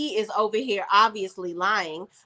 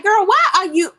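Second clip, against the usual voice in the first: exasperated outburst; 8 semitones higher, 9 dB louder than usual. Real, not acted. Host reacts to Sabrina in loud, high disbelief. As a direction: throw it out like you can't believe her.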